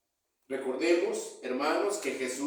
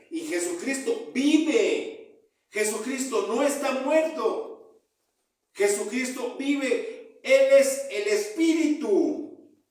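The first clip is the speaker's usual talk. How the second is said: impassioned preaching; 7 semitones higher than usual. Real, not acted. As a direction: proclaim it to the congregation at full force. Preaches at full force.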